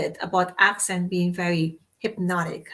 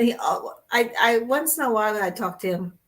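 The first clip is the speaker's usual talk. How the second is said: ordinary delivery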